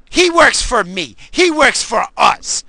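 slow, loud, high insistence; emphatic anger. Real, not acted. Voter insisting who works for whom, angry and emphatic. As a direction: drive it home slowly and loudly.

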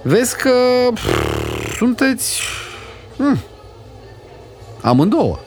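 put-on high, scornful little boy, hesitating before the insult; a spoiled child sneering at two women; bratty boy. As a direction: Goe calling the ladies dim. Goe insults the ladies.

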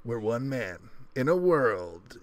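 slow, dramatic trailer narrator voice; movie trailer voice. Shown as a demonstration. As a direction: boom it out like a blockbuster trailer. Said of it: "You should do the movie trailer voice".

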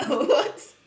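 loud, high mock disbelief; stunned reaction. Telling friends about a surprise slap, stunned. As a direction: yelp it as if just slapped.

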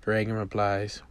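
ordinary delivery

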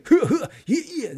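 high loud laughing whoops; hyped cheer. Shown as a demonstration. Showing viewers the hype way to call a name, with laughing whoops. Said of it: "这样，这样来叫".

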